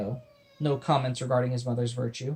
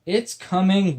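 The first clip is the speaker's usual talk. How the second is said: moaning; 6 semitones higher, 6 dB louder, slower than usual. Acted. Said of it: "William moaned".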